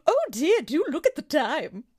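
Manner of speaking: lightly hammed-up posh British accent; mock British accent. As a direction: play a prim English lady in a British accent. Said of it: "I won't do the accent the whole time".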